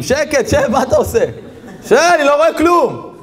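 high, exasperated yelling; annoyed shout. Acted. Audience member shouting at the man blocking the screen. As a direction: shush him sharply, fed up.

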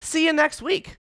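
high, loud dismissal; brisk teacher shout. Retold or imitated. Swim teacher ending the class, loud brisk shout. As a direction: bark the class over with a whistle. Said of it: "She's like".

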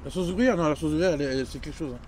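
chewing between words; speaking around food; talking with mouth full. Real, not acted. Seen 1: man holds taco, cheeks puffed, lips pursed while speaking.

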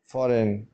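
फॉरेन said "forin", no g sound; फॉरेन with the g left silent. Shown as a demonstration. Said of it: "इसको हम पढ़ेंगे फॉरेन". (फॉरेन में जी silent)

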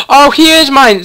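high, loud, thrilled gasp and cry; delighted gasp. Real, not acted. Player thrilled her wolf pup is tamed, delighted gasp. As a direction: burst with joy at the tame pup.

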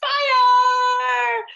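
very high, loud and slow victory cry; triumphant shout. Retold or imitated. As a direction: arms up, shouting the win to the kids. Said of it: "I raise my arms in the air and I say".

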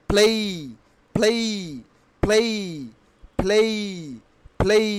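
"play" with a gliding "ei" ending; correct pronunciation. Shown as a demonstration. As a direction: say "play" as "phlei". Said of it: "কি উচ্চারণ হবে". ("play"-কে ফ্লেই বলে)

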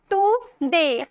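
Today in two separate beats, tu, de; today said slowly as two pieces; split into syllables. Shown as a demonstration. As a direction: break today after the first syllable. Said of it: "दो syllable आए ना".

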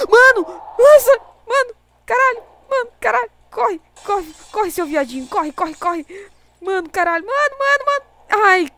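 high, loud panicked screaming; being chased. Real, not acted. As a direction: scream in panic while fleeing. Fleeing monsters in game.

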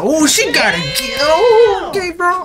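high, whooping excitement; too hyped. Real, not acted. Gamer getting too hyped over a customer, high whoop. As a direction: get carried away and shoot up high. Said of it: "I'm getting too hyped here".

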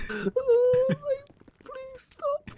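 very high whimpering plea; pleading. Pretend. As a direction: beg in a tiny, crying voice. Tased suspect begs for mercy.

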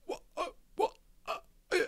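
very high strangled squeak of a stammer. A narrator's reading. Boy stunned by tiny Indian, high squeaky stammer. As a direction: try to speak, only a squeak comes out. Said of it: "his voice just came out as a squeak".

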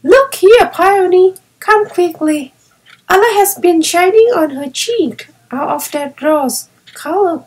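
put-on girl joyfully calling to her brother; loud and joyful. A narrator's reading. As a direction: call your brother over in delight. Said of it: "Violet cried out loudly and joyfully".

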